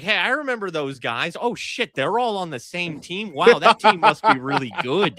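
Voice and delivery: a wide-eyed new fan discovering the team; casual fan. Pretend. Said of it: "you're like".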